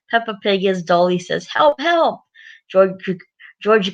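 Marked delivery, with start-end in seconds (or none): 1.5-2.2 high-pitched pretend cries for help; play-acted panic. Peppa's dolly flees the dinosaur, mock panic. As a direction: squeal it like a doll fleeing a monster.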